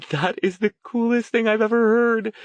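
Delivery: very high, gleeful gush; thrilled young woman. A narrator's reading. Verity to Stone, gushing in a very high voice. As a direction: gush like it's the best news ever.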